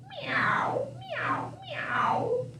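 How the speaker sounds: high mewing meow; cat meow. Acted. Reading the farm book, meowing like the cats.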